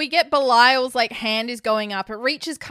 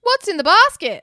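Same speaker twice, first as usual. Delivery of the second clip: high, loud mock outburst; hammed-up movie-line delivery; sudden demanding question; 9 semitones higher, 6 dB louder than usual. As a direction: break off and demand an answer. Acts out monologue suddenly stopping for question.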